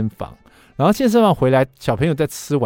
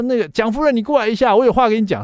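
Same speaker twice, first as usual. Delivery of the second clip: higher, louder call to the next room; 9 semitones higher, 4 dB louder than usual. Retold or imitated. Husband calling his wife from the shower, loud. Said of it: "我当下就立刻大叫".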